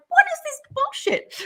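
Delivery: laughing incredulous yelp; incredulous outburst. Retold or imitated. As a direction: squeak it out in mock disbelief, half laughing. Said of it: "I'm like".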